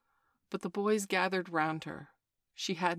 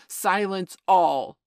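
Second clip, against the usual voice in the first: loud, slow gloating call; 2 semitones higher, 14 dB louder, much slower than usual. Acted. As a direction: call it out, savouring it. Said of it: "he called gloatingly".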